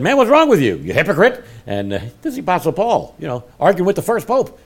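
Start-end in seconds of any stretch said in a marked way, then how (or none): 0.0-1.4 high, loud accusation; confrontational rebuke. Retold or imitated. Paul confronting Peter to his face, loud and high. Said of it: "I got right in his face".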